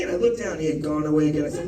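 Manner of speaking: ordinary delivery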